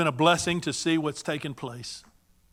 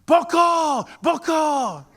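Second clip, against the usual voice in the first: very high, loud hollered reply; popping out with a shout; 11 semitones higher, 7 dB louder, much slower than usual. Retold or imitated. Man at the store answering the hollered greeting, shouted back. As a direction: fire the answer back down the aisle. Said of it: "all of a sudden he'd pop".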